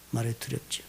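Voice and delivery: soft echo. Shown as a demonstration. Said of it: "repeated phrase, softer echo".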